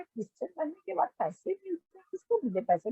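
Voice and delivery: soft, hushed question in disbelief; stunned disbelief. Retold or imitated. To her brother-in-law, hushed and incredulous. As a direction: ask it quietly, as if you can't believe it. Retorts to brother-in-law's money accusation.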